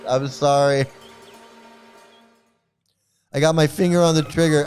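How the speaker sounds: ordinary delivery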